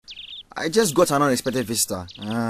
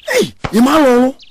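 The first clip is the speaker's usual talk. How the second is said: loud high call across the yard; 7 semitones higher, 5 dB louder than usual. Acted. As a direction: call his name out loud. Calling out to friend.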